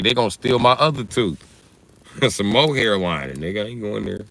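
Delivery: normal talking voice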